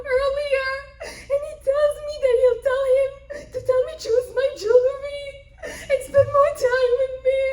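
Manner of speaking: loud, high-pitched sing-song wailing; tearful complaining wife. Acted. Daughter-in-law accusing her father-in-law, wailing tearfully. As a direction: pour it out in a mock sobbing whine.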